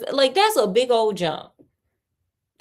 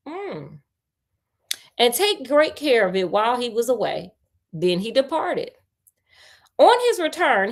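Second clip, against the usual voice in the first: story narration; 4 semitones higher than usual. A narrator's reading. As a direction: read the tale to a friend, a touch dramatic. Reads the tale aloud.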